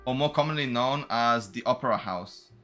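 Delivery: ordinary talk, nothing marked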